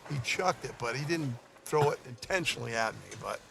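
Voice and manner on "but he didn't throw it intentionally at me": normal talking voice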